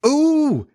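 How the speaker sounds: very high, drawn-out swoon; high delighted "ooh". Acted. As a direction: coo helplessly at the top of the voice. Wendy yields to Peter's flattery.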